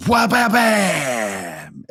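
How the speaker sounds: high, drawn-out crash noise; cartoonish impact sound; collision noise. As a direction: stutter into a long falling bam. Imitating two bodies colliding midair.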